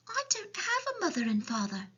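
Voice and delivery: put-on high-pitched little girl voice; small, high child's reply; Sophie. A narrator's reading. Sophie answers the giant.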